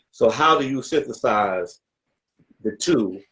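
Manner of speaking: ordinary talk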